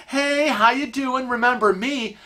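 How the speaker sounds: high, singsong mock greeting; over-friendly greeter. Lapsed clients running over to Kevin, mock over-friendly. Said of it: "have the nerve to come running over".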